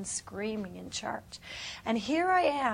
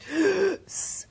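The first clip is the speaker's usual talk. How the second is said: gasp; 8 semitones higher, 5 dB louder than usual. Retold or imitated. Lungs clearing in vision.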